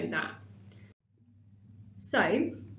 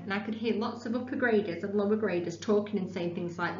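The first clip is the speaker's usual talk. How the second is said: normal talking voice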